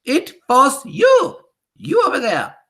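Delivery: very slow, high, loud accusing witch; furious witch accusation. Acted. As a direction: point out the culprit in fury. Accusing the culprit.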